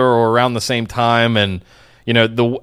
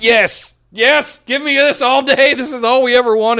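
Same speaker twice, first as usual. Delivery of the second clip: thrilled fan; 11 semitones higher than usual. Real, not acted. Fan cheering a long-awaited feud's return, thrilled.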